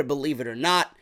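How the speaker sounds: usual speaking voice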